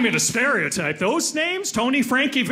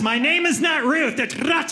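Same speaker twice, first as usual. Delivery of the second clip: high-pitched mock correction; indignant correction; 7 semitones higher than usual. Ruth correcting how her name is said, high mock indignation. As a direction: act put out and insist on the odd pronunciation. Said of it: "They love to correct".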